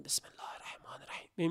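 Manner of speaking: whispered Bismillah with no voice at all; voiceless recitation. Shown as a demonstration. Preacher shows the congregation silent noon-prayer recitation, whispered. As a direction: breathe the words out without voicing them.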